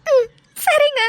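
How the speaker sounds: small answer through tears; tearful agreement. Acted. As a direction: agree while still crying. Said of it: "அழாத, வேதா".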